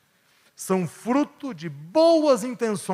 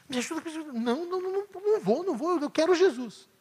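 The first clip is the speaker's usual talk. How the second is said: pleading sister; 11 semitones higher, faster than usual. Retold or imitated. Imagined sisters' squabble.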